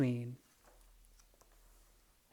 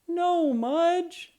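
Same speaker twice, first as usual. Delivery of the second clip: dismayed cry; 5 dB louder than usual. A narrator's reading. Henry sees dog eat flower.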